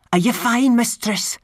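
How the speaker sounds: high wee Scottish pixie voice; a tiny man checking on his mistress; Rob Anybody, high. A narrator's reading. Rob Anybody asks Tiffany, high pixie voice. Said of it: "said Rob Anybody".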